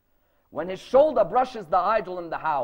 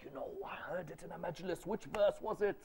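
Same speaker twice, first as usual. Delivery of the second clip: much softer, hesitant mumble; unsure fumbling; 11 dB quieter than usual. Retold or imitated. Debater who cannot recall the verse, unsure fumbling. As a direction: fumble softly for a half-remembered source.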